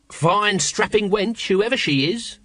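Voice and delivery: high, slow leering appreciation; approving Nobby voice. A narrator's reading. Nobby eyeing the struggling woman, approving high voice. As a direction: savour the words like an old lech. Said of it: "said Nobby approvingly".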